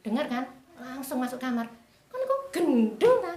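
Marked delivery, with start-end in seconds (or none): 2.1-3.3 high, loud, slow reproach; retold scolding. Mother scolding her daughter, high and loud. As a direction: shout the disbelief at her daughter.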